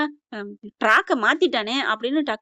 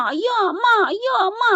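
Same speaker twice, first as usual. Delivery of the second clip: high-pitched yelps at each whip lash; mock cries of pain; 10 semitones higher than usual. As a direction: cry out as if being whipped, hammed-up. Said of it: "அடிக்க". (சாட்டையடி வாங்குவது போல உச்சக் குரலில் அலறல்)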